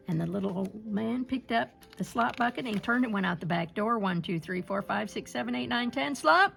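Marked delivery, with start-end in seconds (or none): none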